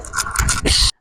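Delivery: soft unvoiced psh; spray hiss sound. Reenacting the boyfriend spraying her, a soft hiss. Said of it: "bro said".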